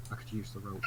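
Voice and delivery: hushed, breathless whisper of a man at the end of his rope; mock despairing whisper. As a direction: breathe it out like a doomed joke. Mock despair in retold scene.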